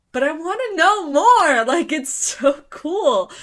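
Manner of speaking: loud, high burst of eagerness; can't contain the excitement; excited. Real, not acted. Gushing about the manga's mystery.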